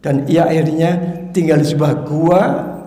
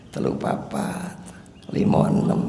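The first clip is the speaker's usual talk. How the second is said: counting under the breath, voiceless; whispered counting; 9 dB quieter than usual. Acted. Preacher teasing dozing listeners, whispered count. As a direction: whisper the count so only the front row hears.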